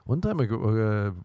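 ordinary talk

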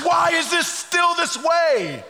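high, drawn-out anguished plea; crying out. Acted. Preacher as grieving Nehemiah pleading with God, crying out. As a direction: cry it up to God in protest. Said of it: "he's crying out to God".

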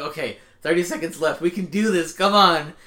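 rising to a loud, high come on; hyped sports fan. Retold or imitated. Fan urging his team in final seconds, building to loud. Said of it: "I was like".

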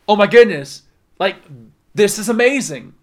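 loud delighted exclamation; a burst of wonder; amazed. Retold or imitated. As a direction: reader discovering something in the Bible, excited. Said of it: "you're gonna go".

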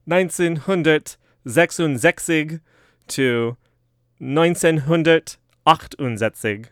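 slow German counting in mock accent; drawn-out German pronunciation; playful German-accented years; German numbers. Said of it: "keep this German accent going".

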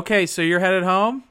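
husband voice. Real, not acted. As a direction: keep it real calm for your wife. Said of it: "I like your husband voice".

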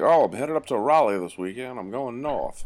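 lightly imitating a Southerner heading north; Southern accent impression. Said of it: "they'll just be like".

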